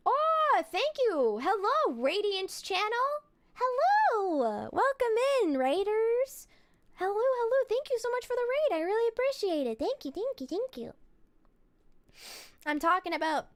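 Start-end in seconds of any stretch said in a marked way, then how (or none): 0.1-10.9 high, swooping hello to arriving raiders; cutesy raid welcome. Pretend. Streamer thanking viewers for a raid, cutesy and high. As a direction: gush thanks in a high cute voice.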